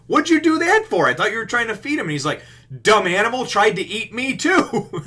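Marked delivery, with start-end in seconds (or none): 0.1-2.1 high, loud, incredulous outburst; hammed-up yell of disbelief; mock outraged scolding. Crew challenging Sanji for kicking the beast, mock outrage. 2.8-5.0 loud, raised-pitch grumbling outburst; mock indignant retort. Sanji justifying his kick to the crew, mock indignation.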